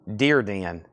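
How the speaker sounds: "Dearden" drawn into three syllables, deer-dee-en; variant deer-DEE-en. Shown as a demonstration. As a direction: say "Dearden" with two long ee sounds. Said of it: "What have I been saying?".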